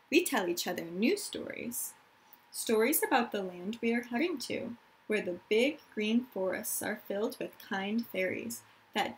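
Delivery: put-on reading aloud to young children; story narration. A narrator's reading. Teacher reads a refugee story to her class, storytelling voice. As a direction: tell the story gently to the class.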